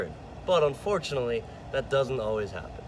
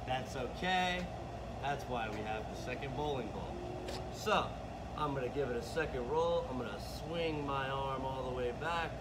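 distant from the mic, much softer; far away; 3 semitones higher, 10 dB quieter than usual. Real, not acted. Coach demonstrating bowling from down the lane, far off. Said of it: "that was a little bit far away".

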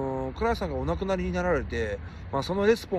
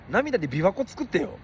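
ordinary delivery

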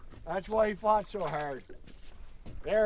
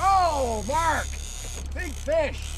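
loud, high excited shout; 8 semitones higher, 3 dB louder, much slower than usual. Real, not acted. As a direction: call out thrilled to the boat. Big fish hooked.

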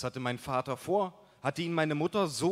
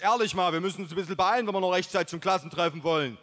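loud, higher put-on buddy voice; rustic buddy Mike; 5 semitones higher, 5 dB louder, faster than usual. A narrator's reading. Buddy pestering his friend about New Year's, loud put-on voice. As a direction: play the pushy, rough-edged pal.

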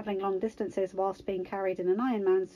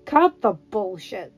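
loud, slow, emphatic cut-in; curt interjection; 7 dB louder, slower than usual. A narrator's reading. Tony cutting off Karpov's denial, forceful interjection. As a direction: interject with hard weight on each word. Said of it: "Tony interjected".

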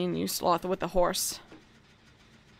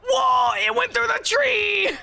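high, drawn-out shout of amazement; surprised shout; 13 semitones higher, 3 dB louder, slower than usual. Real, not acted. Gamer yelling to friend about a glitch, stunned shout. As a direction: yell it like you can't believe it. Shocked at in-game event.

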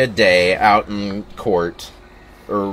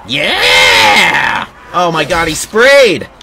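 loud, high cheering yell; loud high whoop he sprayed; excited shout; 6 semitones higher, 6 dB louder, much slower than usual. Real, not acted. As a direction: shout in thrilled disbelief. Excited game reaction.

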